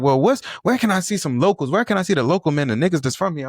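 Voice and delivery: high-pitched mock newcomer; high, lilting mockery of eager transplants. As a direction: imitate a clueless out-of-towner in a high voice. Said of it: "transplants move here and they be like".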